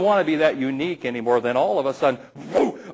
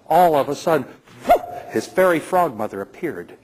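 usual speaking voice

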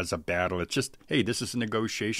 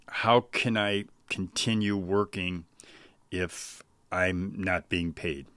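slow, deliberate question, each word weighed; calm firm ask; slower than usual. Shown as a demonstration. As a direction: let the question land slowly and calmly. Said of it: "So that's exactly how I would say it".

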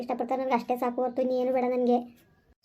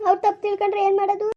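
higher, louder panicked wailing; 5 semitones higher, 3 dB louder than usual. Acted. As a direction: she panics as her husband arrives. Wife panics, husband arrives. (ಗಂಡ ಬಂದಾಗ ಗಾಬರಿಯ ಕೂಗು)

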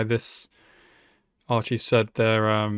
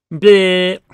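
disgusted bleh; 6 semitones higher, 11 dB louder than usual. Real, not acted. Reacting to anime, disgust noise.

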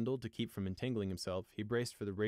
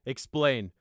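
loud one-word command; curt, raised officer's voice; ordering; 4 semitones higher, 9 dB louder than usual. A narrator's reading. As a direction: order him to account for himself. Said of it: "Valor ordered".

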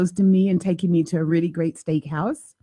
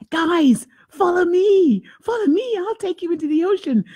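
pretend rescuer voice; 11 semitones higher than usual. Host voicing herself luring sea lions, higher playful call. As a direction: coax them out like a storybook hero. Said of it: "I was making up this story like".

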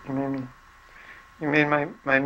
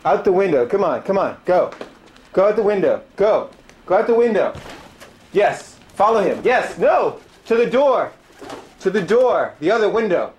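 raised, higher urgent directions; calling out; 7 semitones higher, 4 dB louder than usual. Real, not acted. Shouting at pigeons to fly out the window. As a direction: call the birds toward the window.

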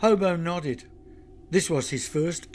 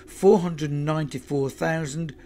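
usual speaking voice

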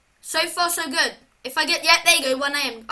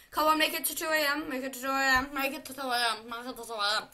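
fast pleading that fades to soft and lower; 4 semitones lower, 6 dB quieter, faster than usual. Real, not acted. Player begging the game clock to reach two AM, fading plea. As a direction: beg under the breath, dying away.

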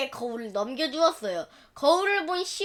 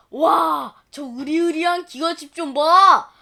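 wide-eyed gasp at the big houses; awed country farmer; amazed farmer; 6 dB louder than usual. Acted. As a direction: gape at the city in wonder.

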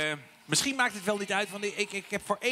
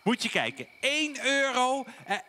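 ordinary talk, nothing marked

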